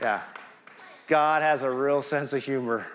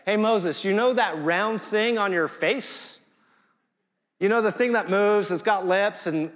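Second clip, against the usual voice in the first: lightly voiced God, higher and teasing; God as a playful friend; 6 semitones higher than usual. God teasing Moses about his own mouth, lightly voiced. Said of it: "this is what God is saying".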